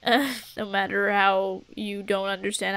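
a hint of a solemn elder; wise old mentor. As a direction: talk like an old teacher sharing secrets. Continues the mentor lecture.

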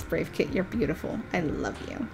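ordinary talk, nothing marked

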